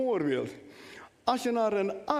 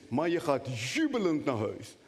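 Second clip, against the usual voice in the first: ordinary talk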